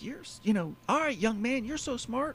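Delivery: put-on a sarcastic young man jibe. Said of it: "And he's like".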